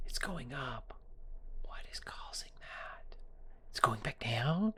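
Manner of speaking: slow hushed stage whisper after a gasp; slow, breathy secret-telling whisper; gasping mock whisper. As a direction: feign shock in a whisper. Mock-dramatic whisper over the chart.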